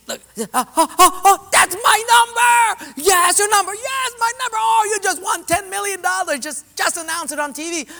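very high, loud delighted squeals; ecstatic disbelief. Retold or imitated. Man discovering his winning ticket, shrieking with joy. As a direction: squeal like you just won millions. Billy finding he won.

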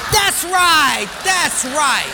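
loud, high cheer, easing off; elated approval. Acted. Personnel guy approves the answer, excited shout. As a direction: cheer the answer like a delighted judge.

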